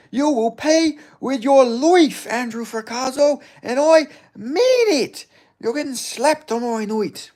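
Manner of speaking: high, loud, slow Irish brogue threat; menacing Irish fighter callout, drawn out; Conor McGregor impression. Streamer mocks chatter in an Irish fighter's voice, slow and loud. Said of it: "I'm doing a fucking impression".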